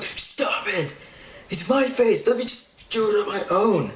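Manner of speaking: high-pitched mock pleading; whiny protest. Patient begging the dentist to stop wiping, high whiny protest. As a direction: squeal it like a fed-up patient.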